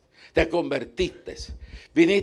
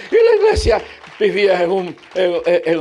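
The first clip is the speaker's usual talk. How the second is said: preaching shout; 3 semitones higher, 8 dB louder than usual. Real, not acted. Preacher denouncing the church.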